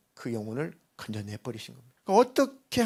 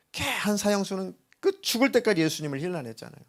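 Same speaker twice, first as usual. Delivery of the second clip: usual speaking voice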